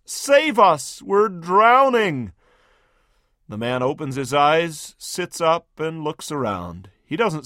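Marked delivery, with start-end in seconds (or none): 0.0-2.3 higher, slow stretched cry for help; a hammed-up shriek, not full out; mild mock shriek. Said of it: "Still another shrieks".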